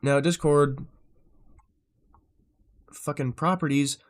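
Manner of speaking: normal talking voice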